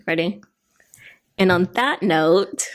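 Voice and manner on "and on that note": normal talking voice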